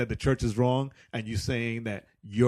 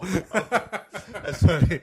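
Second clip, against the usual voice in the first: laughing; 9 semitones higher, 6 dB louder, much slower than usual. Real, not acted. Laughing at the joke.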